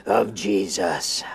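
very slow, drawn-out Pentecostal prayer; hardest prayer. Retold or imitated. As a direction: stretch each word like a fervent preacher. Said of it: "my hardest prayer".

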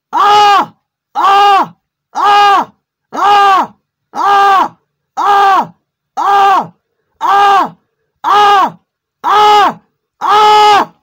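short high screams, one after another; a string of loud "Ah!" yells with short breaths between; repeated screams. Pretend. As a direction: scream at the hard drive, over and over. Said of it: "screaming can be enough to kill".

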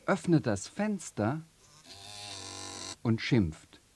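usual speaking voice

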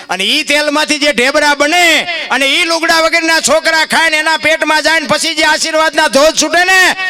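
high, loud fervent preaching; preaching at full force. Acted. Preacher tells listeners about blessings of charity, full-force preaching. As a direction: build the blessing to a ringing peak.

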